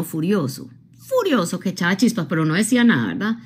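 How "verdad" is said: ordinary delivery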